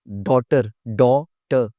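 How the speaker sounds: "डॉटर" slow, with a big rounded aw; exaggerated pronunciation model. Shown as a demonstration. Teacher drilling learners, slow exaggerated "डॉटर". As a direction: stretch "डॉटर" into two slow syllables. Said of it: "मुंह थोड़ा सा exaggerate करने से".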